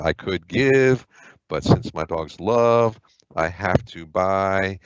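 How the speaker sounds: slow, deliberate reading of each verb phrase; teacher picking out the verbs one at a time. Shown as a demonstration. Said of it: "identify verbs first".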